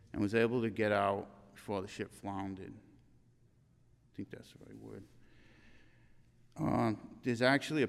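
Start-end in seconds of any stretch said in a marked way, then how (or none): 2.7-5.0 a near-silent breathy aside, slower, to himself; whispered aside. Real, not acted. Speaking at a funeral, a doubtful aside whispered to himself. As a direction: murmur it unvoiced, checking yourself. Unsure aside about word choice.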